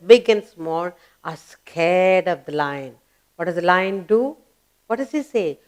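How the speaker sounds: normal talking voice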